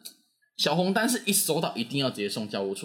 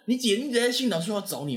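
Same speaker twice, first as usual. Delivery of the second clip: higher excited report; imitating classmate; 10 semitones higher than usual. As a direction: classmate reporting the sister at the office, raised excited voice. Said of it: "他就说".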